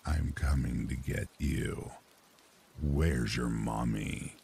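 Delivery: low, slow menacing caller; low creepy man's voice. Retold or imitated. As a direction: threaten slowly in a low voice. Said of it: "a very low and creepy man's voice".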